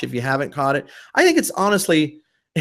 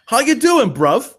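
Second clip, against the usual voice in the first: put-on higher, cheeky London English accent; mock English accent; 6 semitones higher than usual. Host greets his co-host in a mock London English accent. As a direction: lay on a playful London English accent for bruv. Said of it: "we're throwing on our English accents today".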